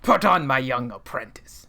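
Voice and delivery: put-on lightly gravelly old Emperor voice; a hint of the Sith Emperor; Palpatine voice. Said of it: "so that was my awful".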